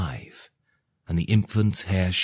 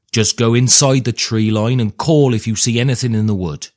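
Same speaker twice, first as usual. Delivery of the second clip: louder call across the meadow; yelling after her; 4 semitones higher, 7 dB louder than usual. Acted. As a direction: call out after a running daughter. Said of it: "her father yelled after her".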